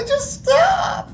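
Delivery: very high, crying plea; pleading whine. Retold or imitated. Sergeant begging the trainees, high crying whine. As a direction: beg them to stop, near tears. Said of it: "you hear it in the back".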